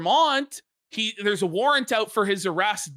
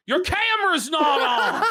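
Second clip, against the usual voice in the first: Southern judge; 7 semitones higher, 3 dB louder than usual. Retold or imitated. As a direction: play the Southern judge, lightly, with a bit of a laugh. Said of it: "the judge is a Southern about".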